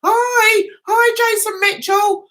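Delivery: high, singled-out hello to a troll; mock-friendly greeting. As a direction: greet him with exaggerated high-pitched cheer.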